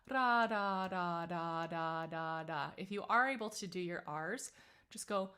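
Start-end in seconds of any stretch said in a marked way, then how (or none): 0.1-2.7 ra, da, da: quick tongue taps on the ridge; ra da drill. Shown as a demonstration. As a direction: tap the tongue up and down, ra da da. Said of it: "you can just kinda play with ra, da, da, da".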